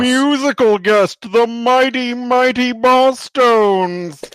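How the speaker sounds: high, loud, slow booming announcer. Pretend. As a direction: introduce the band like a live TV announcer. Mimicking the TV show's announcer introducing the band.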